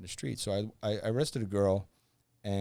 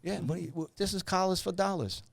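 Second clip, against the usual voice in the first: mocking veteran cops, pitched much higher; incredulous higher mock of the old-timers; imitating salty old cops; 9 semitones higher than usual. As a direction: ham up the scoffing old narcotics guys. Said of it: "they're looking at me going".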